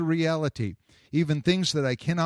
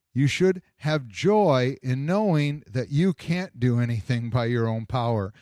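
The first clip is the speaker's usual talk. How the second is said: ordinary talk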